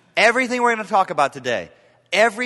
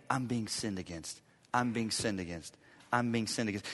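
softer, muttered to oneself; a listener's private grudge, said much softer; inner brooding; 7 semitones lower, 13 dB quieter than usual. Retold or imitated. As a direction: let it sink like a private grudge. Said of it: "start thinking every day".

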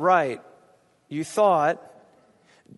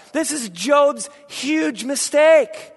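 slow, high, loud declaration; emphatic shout; 7 semitones higher, 4 dB louder, slower than usual. Real, not acted. Preacher warning the church, slow loud declaration. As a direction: hammer the point home slowly.

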